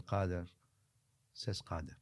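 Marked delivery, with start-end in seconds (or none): 1.6-2.0 a soft, low, humble reply; the sheikh answering the drunkard, soft and humble. Retold or imitated. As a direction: answer humbly and much lower. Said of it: "Humbly so".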